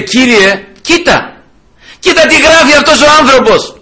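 high-pitched appeal to God; urgent plea. Acted. King shows the letter to God, urgent plea. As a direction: plead upward, each phrase falling away.

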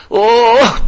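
high, loud mock groan; exasperated groan. Father fed up with daily kneeling, exasperated mock groan. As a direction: groan in protest, imitating.

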